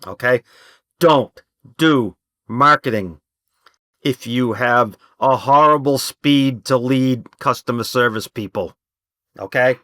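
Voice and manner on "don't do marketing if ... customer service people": louder, slow, punching each word; slow loud warning; loud and emphatic. Real, not acted. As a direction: hammer the warning home to the room. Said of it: "I'm gonna talk loud when I say this point".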